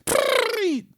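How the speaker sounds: one last please, very high and squeaky; Roger Rabbit. Retold or imitated. Repeats the bit for his viewers, in a very high squeaky cartoon rabbit plea. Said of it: "That was my Roger Rabbit impression".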